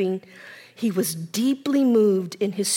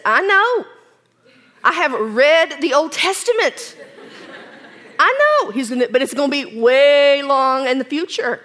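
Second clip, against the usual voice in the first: raised, insistent protest; emphatic Martha; 4 semitones higher, 6 dB louder than usual. Acted. Martha insisting to Jesus she already knows, emphatic and raised. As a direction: cut in with eager certainty.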